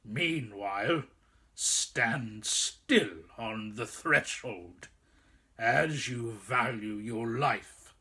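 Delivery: low, slow threatening growl; menacing wolf. A narrator's reading. Wolf warning the boy on the threshold, slow low menace. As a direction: draw out the threat slowly.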